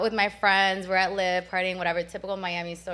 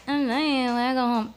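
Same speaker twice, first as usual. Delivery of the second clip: higher, exaggerated whiny mimicry; mocking whine; 4 semitones higher, 4 dB louder than usual. Imitating the rapper mocking her tiredness, whiny and mocking. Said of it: "He mocks me".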